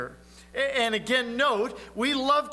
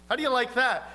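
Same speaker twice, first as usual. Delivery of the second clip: ordinary talk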